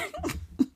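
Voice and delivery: squeaky unvoiced giggle; high breathy giggle. Real, not acted. Reacting to a friend's wild sex posts, a high giggle.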